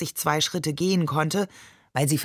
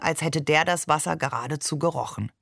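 plain reading voice

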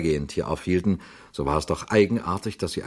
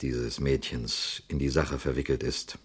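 lowered voice; 6 semitones lower, 6 dB quieter than usual. A narrator's reading. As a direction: lean in and drop the voice as if sharing a dangerous suspicion. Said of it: "Flambeau senkte die Stimme".

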